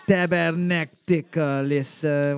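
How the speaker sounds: slow, drawn-out Quebec French curse; French accent. Acted. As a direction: ham up a French-Canadian accent, slowly. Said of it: "I'm not good at a French accent".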